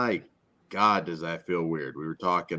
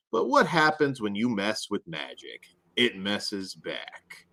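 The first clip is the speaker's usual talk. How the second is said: measured, higher-pitched film blurb reading; synopsis read; 4 semitones higher than usual. Real, not acted. Host reading the film synopsis to co-hosts, dramatic blurb read. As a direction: read it like a trailer, slowing into the last line. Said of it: "after I read the synopsis".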